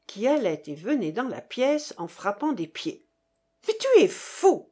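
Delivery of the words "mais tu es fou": loud, high cry of disbelief; exclaimed outburst. A narrator's reading. Russian to partner giving away pearls, crying out. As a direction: burst out in exasperation. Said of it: "s'écria le Russe".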